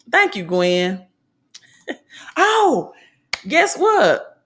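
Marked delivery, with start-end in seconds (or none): 2.4-4.4 excited. Real, not acted. Excited news for Gwen.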